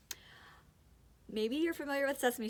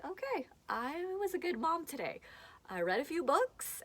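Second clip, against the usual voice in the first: self-congratulation; 8 semitones higher than usual. Acted. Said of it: "I feel like".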